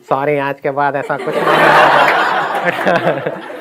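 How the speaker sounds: slow, comical put-on apology; drawn-out mock apology; funny teacher voice. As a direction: imitate the teacher's silly voice. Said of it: "उनकी आवाज़ थी बड़ी funny सी".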